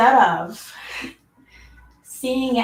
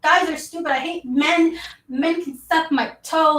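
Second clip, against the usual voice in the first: mock bitter rant; 6 semitones higher than usual. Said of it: "And just like".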